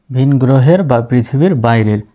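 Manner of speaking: ordinary talk, nothing marked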